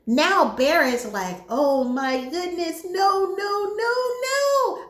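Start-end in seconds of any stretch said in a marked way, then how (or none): 1.5-4.8 horrified refusal climbing higher and higher; mock panic. Bear learning how to break the wish, panicked denial. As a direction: imitate dismay, each "no" pitched up.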